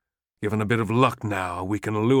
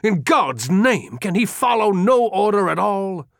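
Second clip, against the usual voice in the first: higher, louder furious outburst; angry snarl; 10 semitones higher, 5 dB louder than usual. Acted. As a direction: explode at the disobedient general. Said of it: "almost snarled".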